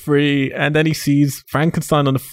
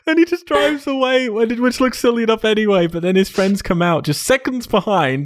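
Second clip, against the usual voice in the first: high, squeaky-with-laughter telling; laughing while talking; 8 semitones higher than usual. Real, not acted. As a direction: struggle to talk through real laughter. Laughing as they recall scene.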